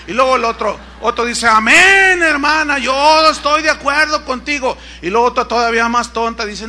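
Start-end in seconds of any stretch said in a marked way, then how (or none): 1.5-4.8 higher, loud enthusiastic shout of agreement; high shouted amen; mock shouted amen. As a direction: holler your support like a fired-up churchgoer.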